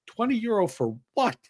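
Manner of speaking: high-pitched mock disbelief; retold baffled reply, rising sharply at the end; incredulous question. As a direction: question it like the offer makes no sense. Puzzled reply to the solicitor.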